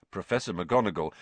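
usual speaking voice

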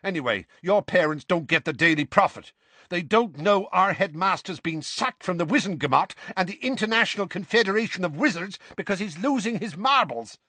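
high, insistent rush of argument; heated arguing. A narrator's reading. Seamus argues with Dean about the headmaster, heated. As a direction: press the point hotly, pitch climbing.

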